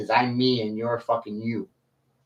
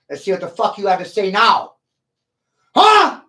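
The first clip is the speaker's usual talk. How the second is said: higher, louder and fast, daring them to answer; loud challenge; 7 semitones higher, 8 dB louder than usual. Real, not acted. Streamer dares his silent chat to speak, shouting. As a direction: throw down the challenge.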